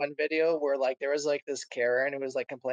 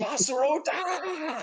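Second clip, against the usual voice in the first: mock dragon shout; 11 semitones higher, much slower than usual. Shouting a Skyrim-style shout.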